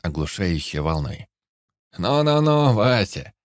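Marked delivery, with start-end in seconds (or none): 2.0-3.3 loud high yell to calm him. A narrator's reading. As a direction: bellow it in the sudden silence. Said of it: "заорал дядя Саша".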